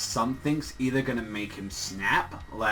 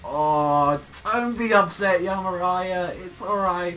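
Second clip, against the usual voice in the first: high, gushing reassurance; hammed-up high consoling voice; cooing comfort; 6 semitones higher, 5 dB louder, slower than usual. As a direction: soothe her in an overdone sympathetic coo. Acted comforting of upset character.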